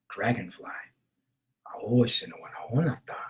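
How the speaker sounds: breathy hushed ritual whisper, a little quick. Real, not acted. Naming the pulled card to viewers, in a whisper. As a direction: hush it like a secret blessing.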